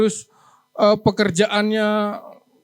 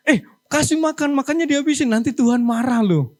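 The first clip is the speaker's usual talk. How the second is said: put-on higher, sing-song nagging at a child; scolding parent; 5 semitones higher than usual. Parent nagging a child to finish food, in a high nagging voice. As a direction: warn the child that God will be angry. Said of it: "ngajarin anak-anak kita gitu".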